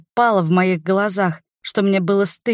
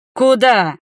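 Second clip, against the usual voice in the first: sharp, louder, higher challenge; snarled; 5 semitones higher, 5 dB louder than usual. A narrator's reading. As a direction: halt him with a snarl. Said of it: "рыкнул Тахир".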